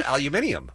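"aluminium" with five syllables, al-you-MIN-ee-um; British aluminium. Shown as a demonstration. Host reporting what he heard abroad, British aluminium. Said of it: "they don't have aluminum, they have aluminium".

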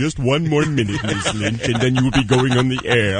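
formal Sulu-like business voice; [name]'s producer voice. Retold or imitated. Cohost as producer reassuring the waiting guest, in formal Sulu style. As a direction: switch back to measured, official phone speech. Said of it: "Why are you doing Sulu".

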